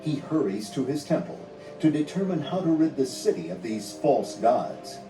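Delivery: usual speaking voice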